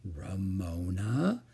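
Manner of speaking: low, soft, slow, rising name; quiet warning. A narrator's reading. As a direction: draw the name out as a hushed warning. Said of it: "said Mother quietly".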